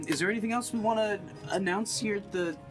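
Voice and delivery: normal talking voice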